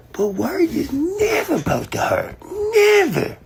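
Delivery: high, strained theatrical voice; hammed-up high-pitched declaration; mock-dramatic put-on voice. Theatrical retort in skit.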